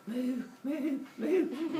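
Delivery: high, contented cooing moos; purring moo. Acted. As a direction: moo like a cow being petted. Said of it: "it starts purring".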